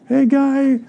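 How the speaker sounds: high-pitched gushing cheer; hammed-up delight, words stretched out; mock excitement. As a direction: play an over-thrilled admirer, voice up high. Acting out cheering over miracles.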